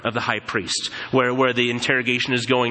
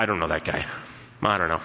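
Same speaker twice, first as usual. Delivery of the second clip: low, soft nervous denial; hushed denial; 9 semitones lower, 5 dB quieter, faster than usual. Retold or imitated. Peter quietly denying to the servant girl. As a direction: mumble it away, caught out.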